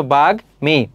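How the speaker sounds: বাগ্মী split as "bag... mi"; teacher's careful "bag-mi" for বাগ্মী; ম fully sounded. Shown as a demonstration. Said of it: "উচ্চারণ অবিকৃত".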